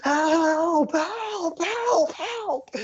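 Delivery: distant victim screaming in panic; terrified screaming. Acted. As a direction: scream as if running for your life. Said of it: "running and screaming your guys' way".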